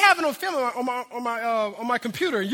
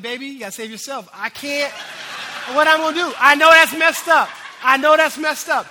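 laughing retelling; 4 semitones higher, 11 dB louder than usual. Retold or imitated. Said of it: "I'm like".

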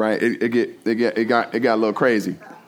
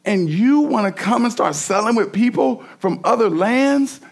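higher, heated rebuke of the people; angry Nehemiah; 7 semitones higher than usual. Retold or imitated. Nehemiah rebukes the people for breaking the Sabbath, heated and rising. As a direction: scold them for repeating their fathers' sin. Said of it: "he shows up with this anger".

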